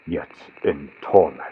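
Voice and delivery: plain reading voice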